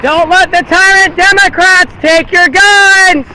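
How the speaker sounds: ordinary talk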